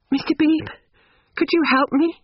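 high-pitched timid appeal; pleading. A narrator's reading. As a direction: ask for help in a very high young woman's voice. Distressed Lucy appeals for help.

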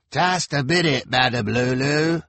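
called from behind a door; through a door. A narrator's reading. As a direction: answer through a closed door. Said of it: "called a voice from behind the door".

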